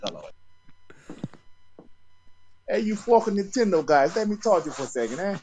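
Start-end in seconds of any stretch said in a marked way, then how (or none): 2.7-5.4 put-on high, loud tough-guy New York Italian accent; hammed-up mobster shaking down the Nintendo guys. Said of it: "let me try my mobster guy".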